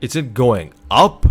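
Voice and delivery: loud, slow, drawn-out question; too close to the mic, loud and slow; yelling into the mic. Real, not acted. Vlogger reading a chart too loudly into the mic. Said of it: "I'm yelling into the mic".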